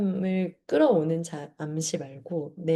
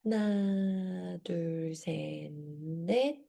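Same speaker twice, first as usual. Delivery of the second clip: slow count, fading softer; slow breathing count; 4 dB quieter, much slower than usual. Shown as a demonstration. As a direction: count the inhale slowly and gently. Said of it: "열 번만 같이 들이마시고".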